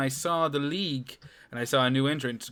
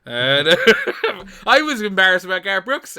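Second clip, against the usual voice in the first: laughs it out, much louder and higher; cracking up while admitting the embarrassment; real laughter breaking through the words; laughing; 8 semitones higher, 15 dB louder than usual. Real, not acted. Laughing at himself after the teasing.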